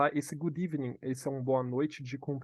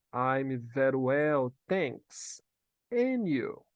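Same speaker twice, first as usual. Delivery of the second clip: teacher modelling; 3 dB louder, much slower than usual. Shown as a demonstration. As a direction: model it slowly for learners to repeat. Teacher models answer for repetition. (devagar, em partes, para repetir)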